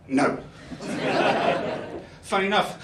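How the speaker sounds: ordinary talk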